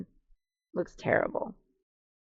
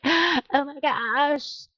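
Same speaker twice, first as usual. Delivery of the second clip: delighted gasp; 7 semitones higher, much slower than usual. Retold or imitated. Admiring her own lawn.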